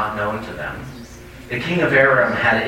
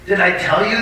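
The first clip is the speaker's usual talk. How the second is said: higher-pitched, mildly indignant exclamation; exclaiming; 8 semitones higher than usual. A narrator's reading. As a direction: read it as a king's raised-pitch exclamation. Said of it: "the king of Israel exclaimed".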